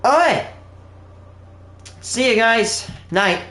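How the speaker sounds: high, bright goodbye call; cheery farewell to the chat; upbeat sign-off. Real, not acted. Signing off the stream cheerfully.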